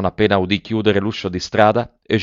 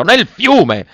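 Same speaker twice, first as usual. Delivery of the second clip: much higher and loud, repeated in horror; echoed outraged yell; 12 semitones higher, 7 dB louder than usual. A narrator's reading. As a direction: fling the words back at her. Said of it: "urlò l'avaro".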